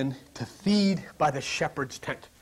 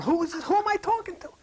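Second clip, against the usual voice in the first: mock bewildered; 12 semitones higher than usual. Acting confused inner dialogue.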